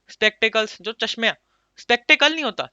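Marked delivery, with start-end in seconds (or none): 1.8-2.7 spectacled punched higher and louder, with no final s; emphatic wrong form. Shown as a demonstration. Teacher warning students, loudly stressing the wrong form. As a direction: hit spectacled hard as the mistake to avoid. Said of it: "बोलते हैं spectacles".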